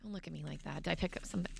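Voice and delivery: quiet aside. Real, not acted. Playful aside to co-host.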